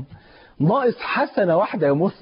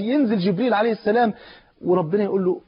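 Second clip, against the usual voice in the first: normal talking voice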